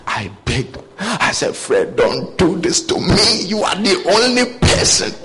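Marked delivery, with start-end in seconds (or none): 1.5-5.1 begging. Retold or imitated. Mentor begging his last disciple Fred to stay, pleading. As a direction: plead with him not to leave. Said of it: "I begged him".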